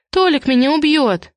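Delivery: high, plaintive dread; girlish worried whine; frightened wail. A narrator's reading. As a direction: wail it high, fearing the worst. Accused girl fears her boyfriend.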